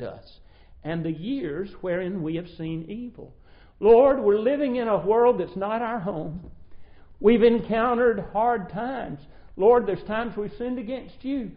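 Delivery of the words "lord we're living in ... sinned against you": louder, earnest prayer; fervent prayer. Acted. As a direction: plead with the Lord aloud. Prays aloud to God.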